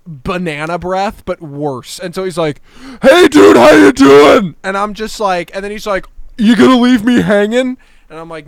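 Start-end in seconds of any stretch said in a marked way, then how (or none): 3.0-4.5 imitating a drunk bar guy, high and very loud; mock shouted greeting. Beer-breath guy greeting a stranger up close, mock shout. 6.4-7.8 imitating a pushy drunk, loud and raised in pitch; mock loud demand. Drunk guy waiting on a high five, loud mock demand.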